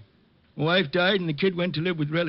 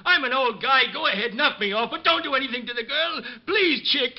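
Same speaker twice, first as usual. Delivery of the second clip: raised, higher voice begging for mercy; desperate pleading; 6 semitones higher than usual. Acted. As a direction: beg him to spare the girl. Old man begging for girl's life.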